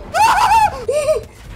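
high, loud fright shriek; sudden high yelp of fright; startled scream. Real, not acted. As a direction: shriek as if jumped by a shark. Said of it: "That scared me".

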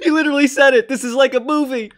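loud, high-pitched excited outburst; thrilled shouting. Real, not acted. Streamer telling viewers he said it, thrilled shout. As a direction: shout it in delighted disbelief. Thrilled reaction to the played clip.

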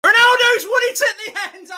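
high, roaring victory scream; long goal yell. Real, not acted. Late winner goes in.

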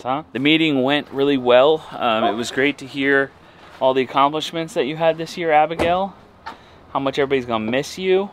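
bright-pitched parental enthusiasm; upbeat parent talk. Real, not acted. Parent cheerfully addressing child Abigail.

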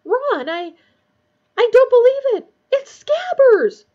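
very high, loud shriek of disbelief; piercing, astonished cry. A narrator's reading. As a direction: shriek it in shock, stretching the last words. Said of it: "she let out a shriek".

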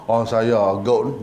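low, slow, ailing-sounding plea; feeble excuse. Acted. Congregant ducks the surau clean-up, feeble and slow. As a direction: drag out a lame sickness excuse.

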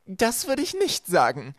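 high, loud, slow gloating voice; villainess calling down. A narrator's reading. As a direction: call it down the shaft, drawn out. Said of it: "ertönte Esmes Stimme von oben". (hoch, laut und gedehnt von oben herab)

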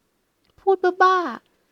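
high, loud, drawn-out embarrassed protest; flustered outburst. A narrator's reading. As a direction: squeal it out, caught off guard. Flustered woman brushes off compliment. (ร้องเขินเสียงสูงดัง ลากยาว)

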